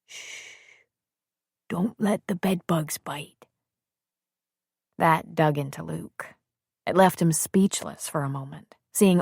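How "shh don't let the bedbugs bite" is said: put-on hushed, soft words to a sleeping baby; man softly hushing a sleeping baby; very quiet. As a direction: hush it to the baby. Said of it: "very quietly he said to her".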